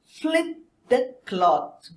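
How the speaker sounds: read-aloud pace for pupils; slow model sentence. Shown as a demonstration. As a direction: Flip the cloth drawn out slowly. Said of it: "repeat after".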